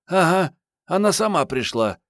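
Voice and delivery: high, lively girl's voice, mocking; teasing young woman. A narrator's reading. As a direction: tease him in a high female voice. (высокий насмешливый девичий голос)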